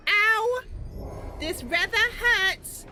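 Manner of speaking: high, loud put-on voice, drawn out; Mister Buns in pain. Mister Buns calls for assistance while hurting, high loud wail. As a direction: cry out high and loud with prim understatement. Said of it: "Mister Buns is like".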